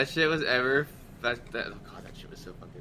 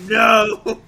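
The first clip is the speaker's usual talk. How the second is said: a loud, higher "No." breaking into laughter; laughing shout; 7 semitones higher, 12 dB louder than usual. Retold or imitated. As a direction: yell the refusal while cracking up. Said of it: "He's like".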